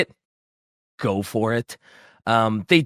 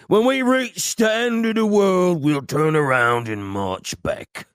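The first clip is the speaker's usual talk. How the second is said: orc quote; 5 semitones higher than usual. Acted. Host reading an orc quote on request, hooligan orc voice. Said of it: "Shai wants me to read the quote".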